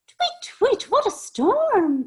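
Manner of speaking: very high, loud little bird; tiny bird twittering at full volume; twittering wren. A narrator's reading. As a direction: chirp it in a squeaky falsetto. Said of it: "twitters wren".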